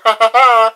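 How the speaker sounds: bursts out laughing loudly; a big loud laugh at the school. Real, not acted. Vlogger laughing at the school that blocked him, loud burst of laughter.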